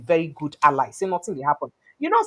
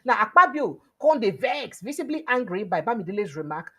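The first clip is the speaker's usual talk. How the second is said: ordinary delivery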